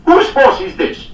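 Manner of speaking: high, loud, slow imperious demand. A narrator's reading. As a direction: bark the question at the yard. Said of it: "he demanded".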